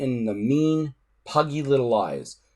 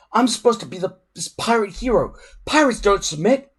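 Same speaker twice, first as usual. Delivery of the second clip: put-on higher, protesting boy's voice; indignant boy; 9 semitones higher, 4 dB louder than usual. A narrator's reading. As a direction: insist on your pride. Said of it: "said Hiccup indignantly".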